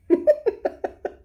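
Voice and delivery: high airy squeal of delight; tickled unvoiced giggle; squealing laugh. Real, not acted. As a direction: let a breathless giggle burst out. Delighted at note left in book.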